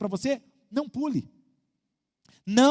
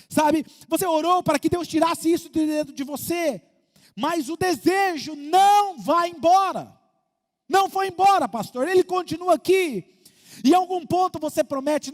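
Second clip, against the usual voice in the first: loud, higher, impassioned sermon climb; fervent preaching; 6 semitones higher, 6 dB louder than usual. Acted. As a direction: push it home from the pulpit. Pregação em força total. (pregação fervorosa, alta e apaixonada)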